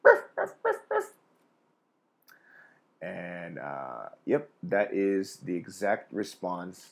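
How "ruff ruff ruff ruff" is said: high, loud yappy barks; dog barking. Retold or imitated. Dog answering what dogs say for emphasis, loud high barks. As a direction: bark it like a little dog. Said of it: "what they say is".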